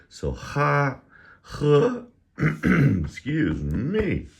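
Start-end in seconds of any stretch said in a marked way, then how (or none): 0.1-2.0 each syllable called out loud and high, slowly spaced; drilling syllables. Shown as a demonstration. Teacher drilling Chinese initials for viewers, loud high enunciation. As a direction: model every syllable crisply, pitched up. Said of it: "a little bit of ha in there".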